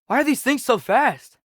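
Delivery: ordinary talk, nothing marked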